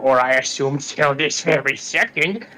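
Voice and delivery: lightly voiced old witch, rising on the barbs; a hint of a spiteful crone. Witch needles Coraline about marriage in a faint crone voice.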